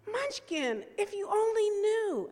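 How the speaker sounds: high, exasperated plea to the kitten. Retold or imitated. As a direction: voice climbing high in loving frustration. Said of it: "and I'm like".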